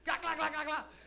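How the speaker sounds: rapid, high clacking noise; imitated clapping. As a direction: imitate shoes slapped together, loud and quick. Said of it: "I just started clapping them".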